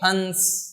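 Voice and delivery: हंस with a long nasal "n" before the s; drawn-out nasal. Shown as a demonstration. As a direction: stretch the nasal in हंस: "hanns". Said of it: "इसको क्या बोलेंगे?".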